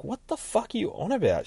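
high-pitched baffled question; confused. Acted. Heroine questions the man toying with her, high and confused. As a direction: pitch it way up in bewilderment. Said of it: "She looks so confused".

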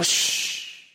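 a breathy, unvoiced "shh"; whispered shush. Party guest hushing the room, whispered shush. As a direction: hush everyone with a soft "shh". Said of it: "And you go".

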